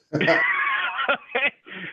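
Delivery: a burst of high, bright laughter at his own story; real laugh. Real, not acted. Telling a business group about buying a house, he bursts into a high laugh.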